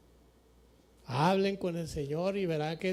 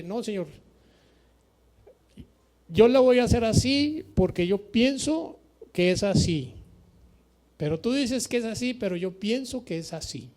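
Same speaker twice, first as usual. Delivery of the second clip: stubborn believer; 3 semitones higher, 7 dB louder than usual. Retold or imitated. Stubborn believer answering God.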